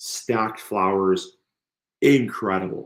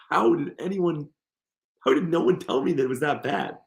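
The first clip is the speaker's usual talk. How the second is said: mock disbelief; 6 semitones higher than usual. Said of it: "I was like".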